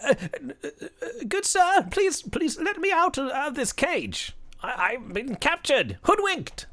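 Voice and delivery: high, stammering captive leprechaun; pleading leprechaun. Acted. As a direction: beg to be let out in a high little voice. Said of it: "And he's like".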